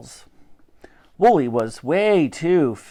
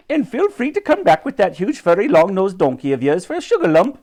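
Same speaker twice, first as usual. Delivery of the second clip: high-pitched shopkeeper calling after a customer; friendly, raised sales patter; Raj; 5 semitones higher, 3 dB louder than usual. A narrator's reading. Raj calls after the departing riders.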